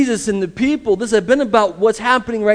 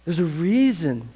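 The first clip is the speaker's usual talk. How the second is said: ordinary talk, nothing marked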